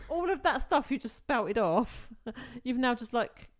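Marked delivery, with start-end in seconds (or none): 0.0-2.3 louder, raised, laughing through words. Real, not acted. Laughing at the retold scene.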